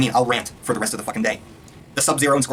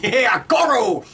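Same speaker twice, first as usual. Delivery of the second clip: slow, high hyped-up cheer; drawn out like a giddy fan spotting Goro; mock excited fan; 9 semitones higher, 4 dB louder, much slower than usual. Fan watching the trailer, cheering slow and high. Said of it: "they're just like".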